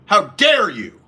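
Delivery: hammed-up how-dare-you; offended lady boy. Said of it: "They go".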